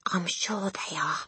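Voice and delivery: slow, hushed whisper. A narrator's reading. Matilda insists intruders are there, slow whisper. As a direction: whisper it with quiet certainty. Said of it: "Matilda whispered".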